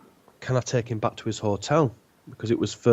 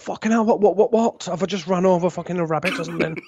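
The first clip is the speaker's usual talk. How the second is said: alarmed; 7 semitones higher than usual. Retold or imitated. Sudden brakes, panicked thought.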